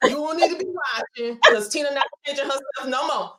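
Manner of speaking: high, sassy and playful, teasing her own grammar. Real, not acted. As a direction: toss it off with a laughing, sassy lift. Playful high mock boast.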